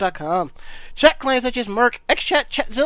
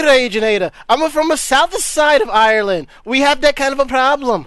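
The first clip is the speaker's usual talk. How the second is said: a touch of stage Irish; light mock Irish answering the chat critic; Irish accent; 3 dB louder than usual. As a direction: defend yourself in mock brogue. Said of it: "your Irish accent sucks".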